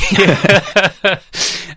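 amused laugh. Real, not acted. Laughs at own slip.

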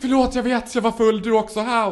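high, loud mock apology; panikslagen ursäkt. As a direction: blurt a hammed-up panicky excuse. Acts out panicked drunken-night apology. (spelad panikursäkt, högt och ljust)

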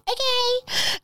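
high squeaky okay; nervous okay. Retold or imitated. Agreeing to take her turn, high nervous okay. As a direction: chirp it nervously.

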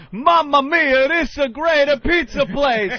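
high, loud hammed-up Italian ad voice; mamma mia pizza guy. Pizza commercial Italian, high and loud hammy. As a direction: sell it like a cheesy pizza ad. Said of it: "this guy's going".

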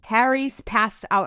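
HARRY'S PASSED OUT said slowly with every sound crisp; over-enunciate each word of "Harry's passed out"; slow, overly careful "Harry's passed out"; over-enunciated. Shown as a demonstration. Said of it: "I over enunciate".

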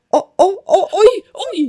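high, repeated startled cries; imitating a startled midwife; alarmed exclamations. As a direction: gasp out each cry, pitched high.

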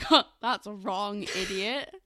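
slow, loud mock correction; imagined put-down. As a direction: play someone scornfully correcting you. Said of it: "be like".

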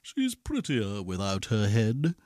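pleased, understated prince; said smiling. A narrator's reading. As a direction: say it with a smile, dropping the voice. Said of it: "He smiled and said".

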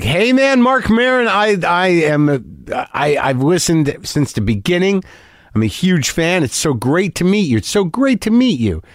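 high, eager fan gushing at a celebrity; earnest fan. Retold or imitated. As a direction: gush like an earnest superfan who just spotted you. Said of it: "Very earnest guy".

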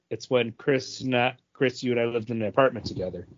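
ordinary talk

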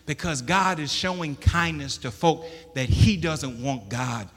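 loud, high, driving preacher's cry; preaching at full force. Acted. Preacher thundering Jonah's complaint to the church. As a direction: preach it hard to the congregation.

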